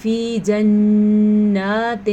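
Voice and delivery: جنات with a nasal doubled nun, very slow; with ghunna. Shown as a demonstration. Teacher showing ghunna on جنات. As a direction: hum on the نون of جنات. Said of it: "غنہ ضرور کرنا ہے".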